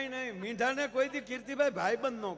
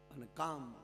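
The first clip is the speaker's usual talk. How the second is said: much softer, lower tender ending; soft tender close; 8 semitones lower, 13 dB quieter than usual. Acted. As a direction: let it fall gently, tenderly. Tender closing line of wife. (ધીમા કોમળ અવાજે)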